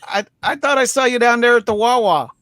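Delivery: high-pitched mock Pennsylvania accent. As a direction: put on a rural Pennsylvania accent, voice jumping up. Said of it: "that's a rough accent".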